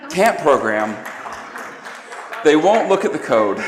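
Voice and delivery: loud, laughing emphasis. Real, not acted. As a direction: punch the point home. Emphatic point to laughing audience.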